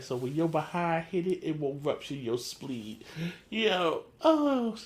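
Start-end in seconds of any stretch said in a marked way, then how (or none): 0.0-3.0 softer, dry menace; understated quiet threat; deadpan threat. Retold or imitated.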